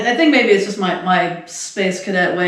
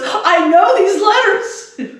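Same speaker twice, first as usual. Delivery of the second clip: high, drawn-out mock exasperation; imitated flustered protest; 10 semitones higher, 3 dB louder, much slower than usual. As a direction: protest slowly in a raised, put-on voice. Acts out the flustered boy protesting.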